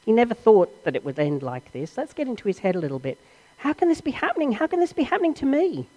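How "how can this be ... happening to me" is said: panicked thoughts. Acted. Thief's panicked inner voice.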